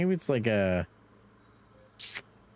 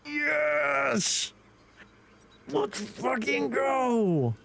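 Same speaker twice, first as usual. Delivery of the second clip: excited cheer; 14 semitones higher, much slower than usual. Real, not acted.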